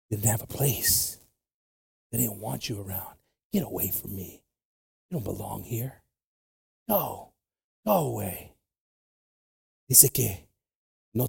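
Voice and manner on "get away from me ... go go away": quiet rejection. Retold or imitated. Voices people rejecting the outcast.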